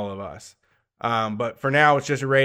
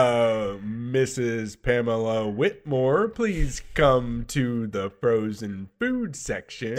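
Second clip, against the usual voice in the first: slow store-announcer voice; intercom radio voice; much slower than usual. Acted. Store worker calling a customer over the intercom, radio voice. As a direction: page a shopper over the store intercom, drawn out. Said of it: "they use, like, a radio voice".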